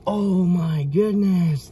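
slow, drawn-out amazement; real astonishment, slow and loud; awed. Real, not acted. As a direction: stretch out each word in wonder. Awed at the vein.

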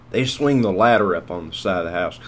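ordinary delivery

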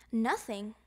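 plain reading voice

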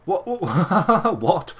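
loud, high laughing "what?"; real startled laughter. Real, not acted. Gamer seeing a comical mustache, amazed laughing outburst. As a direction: burst out laughing in disbelief. Amused by character's apparent mustache.

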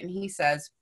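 ordinary talk

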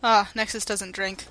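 ordinary talk, nothing marked

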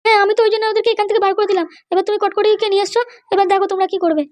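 a high female character voice; mother's voice. Acted. Mother explains to Kotkoti.

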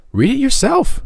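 put-on very high, ecstatic boyish cry; joyful cry. A narrator's reading. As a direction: shout it out bursting with joy. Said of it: "cried Tom".

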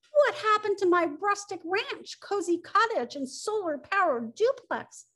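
high, swooping storybook lament; dismayed outcry. Acted. Woodpecker wailing over his vanished houses, high and dismayed.

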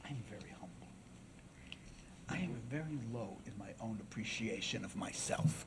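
hushed, low courtroom humility; soft-spoken orator. Acted. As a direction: speak so softly they strain to hear. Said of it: "he talked very softly".

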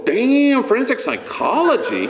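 high startled Damn; surprised. Retold or imitated. Priest reacts to Jessica's choice, surprised high voice.

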